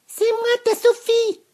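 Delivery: very high, very loud swooning cry; bearded lady calling. A narrator's reading. Sophie spots Vénou across the class, swooning cry. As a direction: call out Vénou in adoration. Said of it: "dit-elle".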